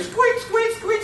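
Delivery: high squeaky marker noise; very high mock squeak of a marker; imitated highlighter squeak. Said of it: "It's called the squeak process".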